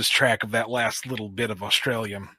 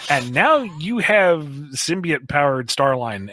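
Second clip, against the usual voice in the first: ordinary talk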